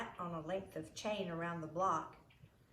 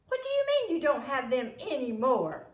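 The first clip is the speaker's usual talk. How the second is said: much louder, higher shocked question; alarmed principal; 7 semitones higher, 10 dB louder than usual. A narrator's reading. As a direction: demand it, startled and alarmed. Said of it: "the principal said".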